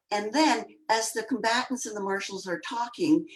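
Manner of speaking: normal talking voice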